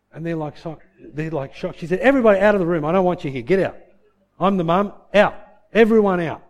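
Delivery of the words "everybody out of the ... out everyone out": loud orders from a mum in charge; commanding order. Retold or imitated. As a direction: clear the room, loud and forceful. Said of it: "Ordered them all out".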